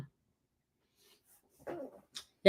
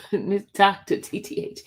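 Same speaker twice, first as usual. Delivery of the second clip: normal talking voice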